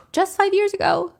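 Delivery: "Just five years ago" repeated high in disbelief; incredulous mockery. Real, not acted. Host mocking the guest's claim, high and incredulous. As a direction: throw her words back, mocking.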